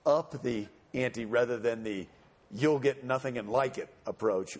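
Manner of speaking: ordinary delivery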